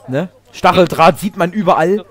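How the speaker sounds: ordinary delivery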